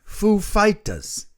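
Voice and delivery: high, slow celebrity impression; Christopher Walken. Host nailing the band introduction, high slow Walken impression. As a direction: stretch it and lift the pitch. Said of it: "That's kinda how he said it".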